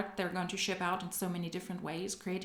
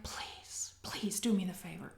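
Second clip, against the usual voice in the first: mock pleading whisper; 8 dB quieter than usual. Begging the clip for a word.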